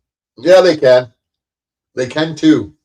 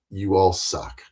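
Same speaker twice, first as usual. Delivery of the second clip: low, soft flat you all suck; quiet dismissive repeat; muttered; 6 semitones lower, 7 dB quieter than usual. Real, not acted. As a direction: drop it down to a mutter. Taunts YouTubers, then repeats low.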